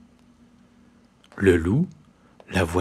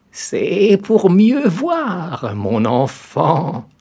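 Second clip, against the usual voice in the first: high, very slow sly granny voice; the disguised wolf reassuring the girl; 8 semitones higher, 6 dB louder, much slower than usual. A narrator's reading. Wolf answers as grandmother.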